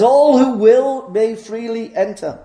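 high, loud, slow proclamation; proclaiming. Acted. Preacher reading the door's sign as he goes through, proclaiming. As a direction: declare it slowly and loudly to all.

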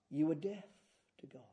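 ordinary talk

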